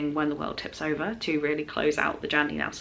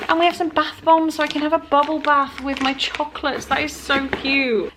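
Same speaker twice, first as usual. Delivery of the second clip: higher, louder, delighted squeal; thrilled gushing; 9 semitones higher, 4 dB louder than usual. Real, not acted. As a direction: gush over the gift, voice way up. Opening a gift with bath bombs.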